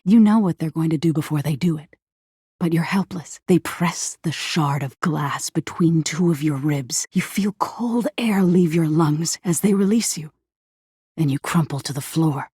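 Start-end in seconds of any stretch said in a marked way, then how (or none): none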